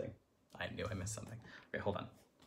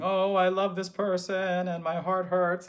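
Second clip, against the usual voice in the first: louder, higher, hammed-up heartbroken lover; mock lovesick singer; 7 semitones higher, 10 dB louder than usual. Teacher mocks the opera singer's love lament for her class. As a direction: ham up the aching love song. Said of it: "He's gonna be saying".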